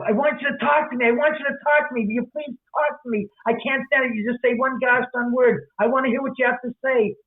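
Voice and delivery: pleading suitor. Retold or imitated. Kotter begs the silent beauty to talk, mock pleading. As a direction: beg her to speak, half-joking.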